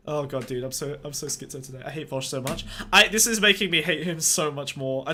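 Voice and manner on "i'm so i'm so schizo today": normal talking voice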